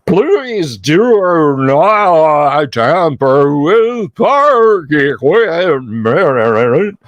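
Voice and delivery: slow, warped, glitching machine voice; distorted warbling robot voice. Acted. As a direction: drag it out like a broken robot. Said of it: "this kind of distorted warbling voice comes out and says".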